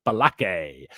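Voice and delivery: Balake said "buh-LAH-kay"; sketch name quote. Retold or imitated. Quoting the sketch teacher, Balake "buh-LAH-kay". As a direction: read Balake as the teacher did. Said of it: "sketch of him in the classroom".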